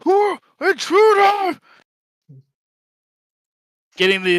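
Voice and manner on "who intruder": high-pitched, loud shout; shrill-pitched alarm cry from a goblin; goblin yelling alarm. Acted. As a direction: yell it out to raise the camp.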